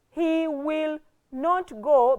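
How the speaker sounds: "He" held high and drawn out, slow sentence; emphasis on HE. Shown as a demonstration. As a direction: set "He" apart slowly as the subject. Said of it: "we would put emphasis on he".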